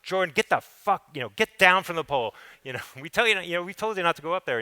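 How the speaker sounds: high, loud scolding mothers; mildly imitated mothers yelling at a kid on a pole; women yelling. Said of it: "they start screaming at him".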